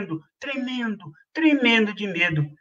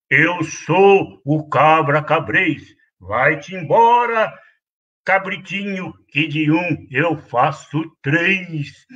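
low, slow, gruff goat threat; menacing billy goat; 4 semitones lower, slower than usual. Acted. Bully goat chasing the kid away, slow low threat. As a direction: loom over him, slow and low.

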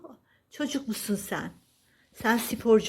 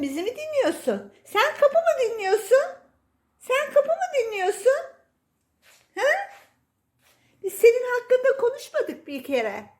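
high, cooing pet-talk voice; baby talk to cat; 13 semitones higher, 4 dB louder than usual. Acted. Owner cooing to her cat, high baby talk. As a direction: gush over the cat like a baby.

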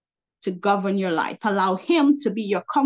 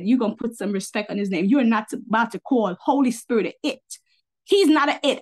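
usual speaking voice

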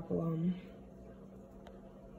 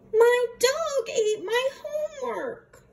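put-on high, urgent kid excuse; childish excuse; 12 semitones higher, 3 dB louder than usual. David blurts excuse to teacher, high childish voice.

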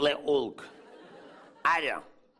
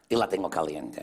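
low punchline offer; 9 semitones lower than usual. Retold or imitated. Beautician offers client the hot wax, low voice. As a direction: drop very low on the last option.

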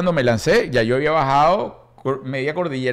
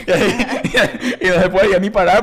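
laughing; 4 semitones higher than usual. Real, not acted. Father laughing at the memory of his daughter. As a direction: laugh through the picture.

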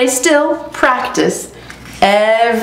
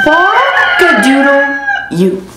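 closing rooster crow; 4 semitones higher, much slower than usual. Acted. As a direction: stretch the final crow out long. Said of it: "And Rudy says".

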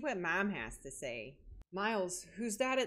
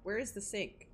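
ordinary talk, nothing marked

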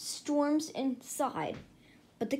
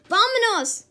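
loud, higher; rallying cry; 10 semitones higher, 12 dB louder than usual. A narrator's reading. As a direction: rouse the dogs to go. Rallying the pack.

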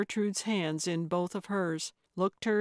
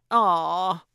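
loud sleepy groan of protest; groggy grumble; 8 dB louder than usual. A narrator's reading. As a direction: grumble awake, annoyed. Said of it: "she grumbled".

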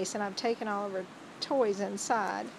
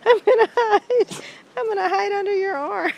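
much higher, louder, playful dog voice; voicing the dog; 11 semitones higher, 11 dB louder than usual. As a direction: speak for the hiding dog, squealing fast. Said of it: "She says".

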